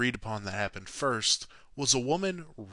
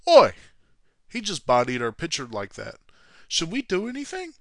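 lightly mocked rowdy fans, pitched up; outraged fans; 6 semitones higher, 6 dB louder than usual. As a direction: call out like an indignant crowd. Fans acted out, calling out.

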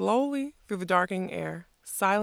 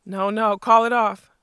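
louder, rising panicked plea; alarmed cry; 2 semitones higher, 9 dB louder than usual. A narrator's reading. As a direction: shout it, ducking the hat.

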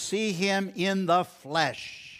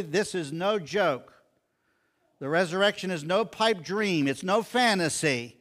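higher, punched declaration with firm beats; very emphatic; 4 semitones higher, 3 dB louder than usual. Retold or imitated. Job insisting the resurrection is real, very emphatic. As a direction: drive each denial home. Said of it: "Job is saying very emphatically".

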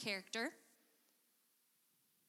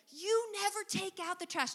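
high, pointed accusation with YOU stressed; nagging partner voice, you-statement; accusing complaint; 8 semitones higher than usual. Shown as a demonstration. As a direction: throw the blame with You. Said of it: "Do you hear the difference?".

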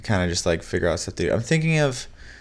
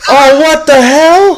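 startled laughing shout; 12 dB louder, slower than usual. Real, not acted. Object suddenly falls during recording.

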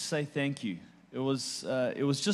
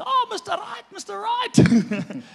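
high, drawn-out shout in imitated Cape Coloured accent; hammed-up Cape Coloured squeal of greeting; Cape Coloured Afrikaans accent; 7 dB louder, slower than usual. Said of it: "that's a Cape Colored Afrikaans accent".

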